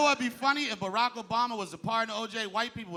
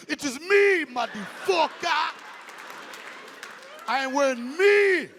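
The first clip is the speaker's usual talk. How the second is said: put-on loud, drawn-out African accent boast; proud African father, hammed up, slow and high; mock African accent; 6 semitones higher, 5 dB louder, slower than usual. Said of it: "Barack father was African".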